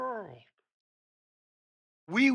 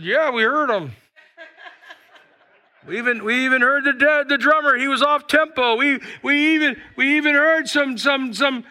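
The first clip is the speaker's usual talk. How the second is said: higher and louder, laughing as they report back; chuckling angels; 7 semitones higher, 8 dB louder than usual. Acted. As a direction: stammer it out amused, like gossiping. Angels answering God, laughing.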